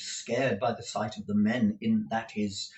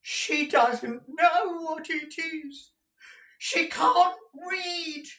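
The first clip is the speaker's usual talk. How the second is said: very high, slow wailing shriek; piercingly pitched, drawn-out miser's cry; slower than usual. A narrator's reading. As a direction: shriek it out as a distraught old man. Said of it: "shrieked Gride".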